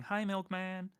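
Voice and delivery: chirpy high hellos to each chatter; SpongeBob-style greetings. Pretend. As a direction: greet each name like a cheerful cartoon sponge. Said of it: "I'm gonna do the SpongeBob thing".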